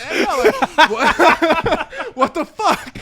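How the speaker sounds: loud put-on tough guy, breaking into a laugh; mock menace that cracks up; man voice. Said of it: "I had to use my man voice".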